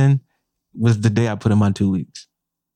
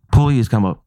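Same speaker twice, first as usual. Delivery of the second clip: ordinary talk, nothing marked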